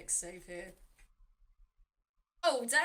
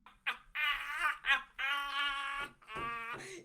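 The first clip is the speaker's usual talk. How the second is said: gleeful mocking cackle; 4 semitones higher, 4 dB louder than usual. In the throes of a cackle.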